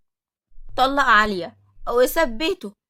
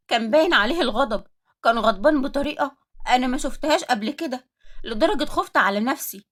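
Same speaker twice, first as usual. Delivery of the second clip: ordinary delivery, nothing marked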